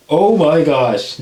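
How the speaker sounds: excited. Real, not acted. Dramatic slow exclamation.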